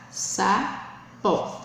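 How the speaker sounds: "sapo" split in two, sa... po; syllable by syllable. Shown as a demonstration. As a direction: say "sapo" one syllable at a time. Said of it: "formada por duas sílabas". ("sapo" separando as sílabas)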